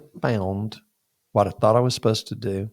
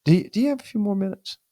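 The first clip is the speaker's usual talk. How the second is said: dean's question; 9 semitones higher, faster than usual. Retold or imitated. Dean voiced in retold story.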